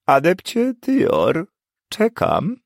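impatient professor. Acted. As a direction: make him squirm waiting.

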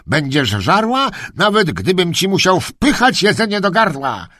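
high-pitched angry tirade; furious threat. Acted. Kennelman threatening the dog behind the fence, furious. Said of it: "wściekle odezwał się do psa".

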